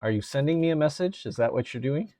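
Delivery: normal talking voice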